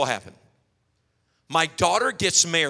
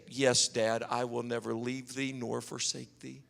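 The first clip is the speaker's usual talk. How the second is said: daughter's soft reply; 6 semitones lower, 10 dB quieter than usual. Retold or imitated. Daughter voiced promising to stay.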